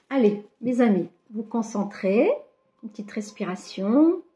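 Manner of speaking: ordinary talk, nothing marked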